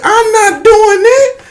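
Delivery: very high, slow mock wail; crying refusal. Boy refusing the machete from his uncle, crying. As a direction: whine it like a sobbing child. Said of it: "like, crying".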